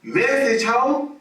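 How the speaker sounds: high sing-song notification call; phone alert voice. Retold or imitated. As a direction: mimic the phone chiming out its alert.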